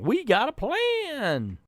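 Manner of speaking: high, slow, stretched mocking quote; mocked teens. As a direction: parody them with drawn-out silliness. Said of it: "they're just saying".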